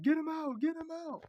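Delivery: very high squealed plea; high-pitched mock panic; mock alarm. Acted cry about Jake the dog.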